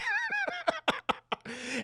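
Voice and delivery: very high staccato mock cackle; villain cackle. Chucky holding a grenade, villain cackle. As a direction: cackle in a rapid high string.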